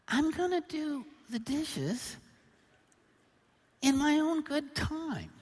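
high, slow, drawn-out stubborn reply; hammed-up husband's defiance; defiant retort. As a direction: dig your heels in, spacing the words. Acted stubborn retort to wife.

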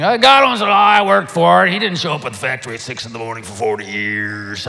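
lightly voiced indignant churchgoer; mock grumbling objector. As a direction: play the skeptic who earned every penny. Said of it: "I don't know why I talked like that".

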